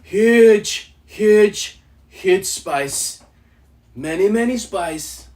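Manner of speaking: loud Trump "huge"; boastful loud Trump impression. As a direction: hammer it out like Trump at a rally. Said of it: "Do Donald Trump".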